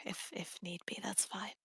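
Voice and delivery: soft whispered aside to herself; whispering. Real, not acted. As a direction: drop to a whisper. Player trails off to herself.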